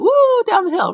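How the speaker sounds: high, loud whoop down the hill; excited kid whoop. Retold or imitated. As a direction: whoop like a boy playing. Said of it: "most boys will do that".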